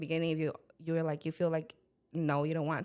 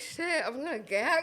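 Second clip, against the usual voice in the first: laughing; 7 semitones higher, slower than usual. Retold or imitated. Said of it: "I was like".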